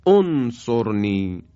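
انصرنی said as "onsorni", opening on o; damma start. Shown as a demonstration. Teacher shows the damma start to students. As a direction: start انصرنی with a rounded o, slowly. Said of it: "باید با ضمه بخونیم".

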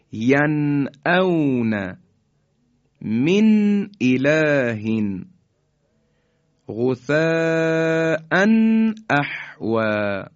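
ینعون من اله غثاء احوا recited very slowly, each nun sakin crisp and unvoweled; slow, careful Quranic recitation with a clean nun before the throat letter; correct clear izhar recitation. Shown as a demonstration. Teacher recites the correct examples slowly for students. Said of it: "به این شکل".

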